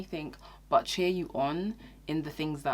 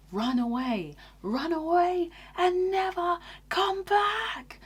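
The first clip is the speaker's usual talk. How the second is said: hammed-up frantic, high and slow; mock panic; 13 semitones higher, 5 dB louder, slower than usual. Mocking overblown advice, high frantic run away. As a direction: wail it like a cartoon alarm.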